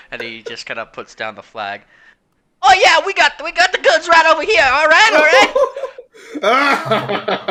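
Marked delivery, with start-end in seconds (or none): 2.6-7.5 much higher and louder hawker voice; loud eager pirate. Acted. Pirate shouting across to the ship, eager hawker. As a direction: sell it like a shady pirate dealer.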